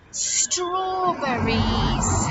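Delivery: "Strawberries" very slow and loud, each syllable drawn out; "Strawberries" with long S and long syllables; stretched-out word. Shown as a demonstration. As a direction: stretch "Strawberries" out for children to copy. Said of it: "let's say strawberries".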